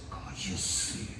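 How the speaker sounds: whispered, stern hush; hushed order. Retold or imitated. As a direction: whisper him to be quiet. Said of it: "el diácono llegaba y le decía".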